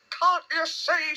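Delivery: high, drawn-out squeaky protest; mild shout. A narrator's reading. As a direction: cry out slowly in a small high voice. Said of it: "shouted Piglet".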